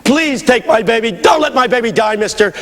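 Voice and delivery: high, urgent begging, first words drawn out; desperate pleading. Retold or imitated. Mother begging the man who pushes her aside, desperate plea. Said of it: "she kept on pleading".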